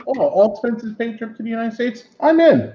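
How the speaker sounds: high-pitched mock eagerness; eager prisoner. Prisoner joking about a trip to America, mock eager.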